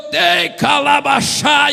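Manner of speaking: loud, slow, strange tongue-speaking shout. Retold or imitated. Believer beside him shouting in tongues. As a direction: bellow fake tongues. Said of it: "alguém do meu lado gritou".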